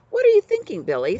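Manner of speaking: high, loud squeak of a voice; a nervous sidekick squeaking up at his boss; squeaky Cutwater. A narrator's reading. Cutwater asks Billy, high and loud.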